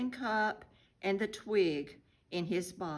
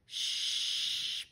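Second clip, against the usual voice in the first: long unvoiced shush; hushing. A narrator's reading. Friend holding the boy back, hushing him. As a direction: hush him with a drawn-out shh. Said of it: "said one of Jesus' friends, holding Benjamin back".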